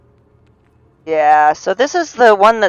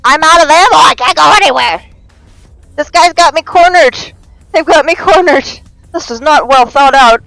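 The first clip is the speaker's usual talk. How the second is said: high, loud yelling, cornered; frantic high-pitched complaint under attack; panicking; 8 semitones higher, 5 dB louder than usual. Real, not acted. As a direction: cry out frantically for a way out. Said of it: "I'm panicking!".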